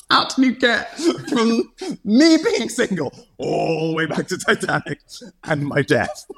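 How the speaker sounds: high, slow incredulous friend voice; imitating friend. Friend asks how single links to Titanic, high incredulous. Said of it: "she's like".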